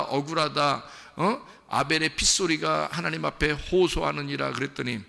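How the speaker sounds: ordinary talk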